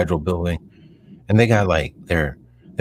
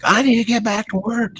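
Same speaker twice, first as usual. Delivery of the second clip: much higher whiny mock voice; mock complainer. Mocking people pissed off about lockdown, high. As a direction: whine it like a fed-up protester. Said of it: "and like".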